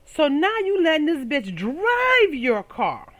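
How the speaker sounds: high, slow outraged cry at her man; drawn-out indignant oh, then slow accusation; mock outraged. Girlfriend scolding her man for letting another woman drive. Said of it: "I was like".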